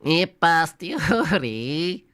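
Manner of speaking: put-on slow, hammy Italian accent on the Latin; stretched pasta-chef Italian accent; mock Italian accent. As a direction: say it like a stage Italian, drawn out. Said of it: "in an Italian accent".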